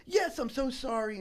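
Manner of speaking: high-pitched imitation of a tearful apology. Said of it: "And she starts bawling".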